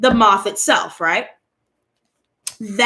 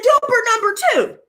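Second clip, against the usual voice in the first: mild motherly scolding; 8 semitones higher than usual. Retold or imitated. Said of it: "So I said".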